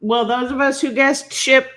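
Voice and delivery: ordinary talk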